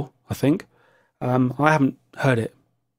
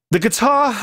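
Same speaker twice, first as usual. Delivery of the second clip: put-on American accent; 9 semitones higher than usual. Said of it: "he would have said it in an American accent".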